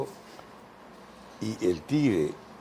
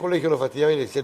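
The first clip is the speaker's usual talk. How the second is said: usual speaking voice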